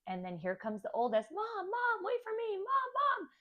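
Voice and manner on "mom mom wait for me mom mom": put-on high, eager kid calling Mom; high childlike calling, eager not to be left behind; excited child calling. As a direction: call after her like an excited little boy.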